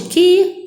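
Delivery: QUI alone, pitched high and punched; teacher singling out a high QUI; stressed syllable demo. Shown as a demonstration. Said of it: "Antepenúltima forte".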